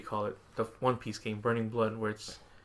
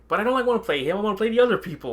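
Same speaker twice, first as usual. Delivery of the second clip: excited; 10 semitones higher, 7 dB louder, faster than usual. Real, not acted. Excited complaint about character choice.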